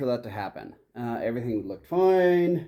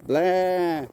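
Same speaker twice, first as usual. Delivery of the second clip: mocking animals; 5 semitones higher, much slower than usual. Mocking the noisy farm animals.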